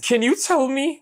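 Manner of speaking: high mocking whine of Portuguese-accent plea; mock high-pitched plea. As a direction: squeak it back in mockery.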